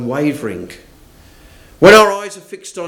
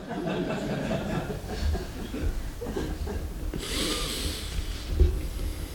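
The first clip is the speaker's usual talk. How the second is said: laughter; 15 dB quieter than usual. Real, not acted. Congregation laughs.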